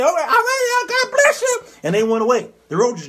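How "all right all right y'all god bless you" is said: high, sing-song churchgoer farewell; lifted high preacherly goodbye; churchy roach goodbye. Acted. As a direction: bless them on the way out, way up high.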